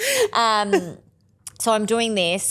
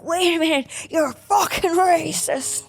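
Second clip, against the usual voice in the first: put-on drunk Irish heckler; 10 semitones higher than usual. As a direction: imitate an indignant Irish heckler. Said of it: "three drunk guys turn out to be Irish".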